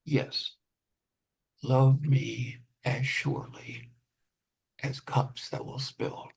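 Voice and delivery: slow measured poetry reading; poem reading. Acted. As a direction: read it like a poet, unhurried. Poet reads own poem.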